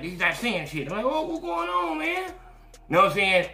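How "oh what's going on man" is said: high-pitched, drawn-out innocent voice; mock-innocent high voice; playing clueless. Acting out himself playing clueless.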